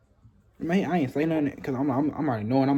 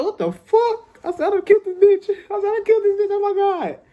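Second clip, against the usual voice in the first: much higher, louder frantic panic; panicked shriek; 7 dB louder than usual. Retold or imitated. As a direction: freak out like she's dead. Thinks he killed the girl.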